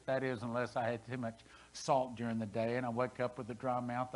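ordinary talk, nothing marked

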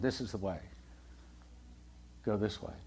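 soft, low, gentle inner voice; quiet voice of God. Retold or imitated. The Lord's voice behind the believer, hushed and low. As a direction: murmur it like a still small voice. The Lord guiding at a decision.

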